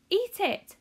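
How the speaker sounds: high, loud urging shout; excited shout. A narrator's reading. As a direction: push him to eat, thrilled. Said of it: "shouted Mister Wonka".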